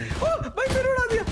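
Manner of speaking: very high, startled complaint; high-pitched gamer dismay; dismayed outcry. Real, not acted. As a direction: cry out high at being knocked off. Knocked off again in the game.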